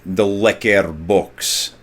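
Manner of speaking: put-on slow Russian-accented lecker box; Billy Crystal's Russian accent trick, drawn out. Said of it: "he said it like".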